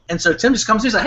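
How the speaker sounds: ordinary talk, nothing marked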